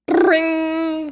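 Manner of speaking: high, loud ringing like a telephone; phone ring. Pretend. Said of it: "A telephone".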